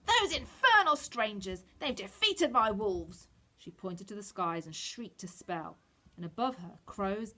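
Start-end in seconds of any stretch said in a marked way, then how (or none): 0.1-3.3 loud, slow, furious shriek; raging witch. A narrator's reading. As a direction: spit it out in fury. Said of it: "Shaking with rage".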